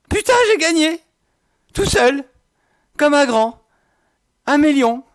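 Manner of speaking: high-pitched shrieks of joy; shrieking jackpot winner. Real, not acted. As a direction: scream it out in real delight. Wins the million in the game.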